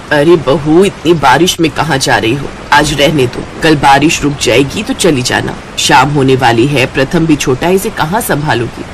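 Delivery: put-on low-pitched, concerned older woman; mother-in-law voice. Mother-in-law urges her daughter-in-law to stay home, low voice.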